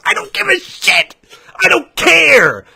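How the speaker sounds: high, strained angry scream; exasperated yelling. Real, not acted. Ranter answering console-war sales boasts, exasperated yelling. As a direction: scream it in exasperation, stretching the last word.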